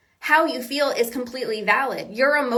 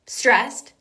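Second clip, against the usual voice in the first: ordinary talk, nothing marked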